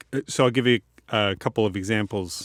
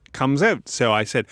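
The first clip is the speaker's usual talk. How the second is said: ordinary delivery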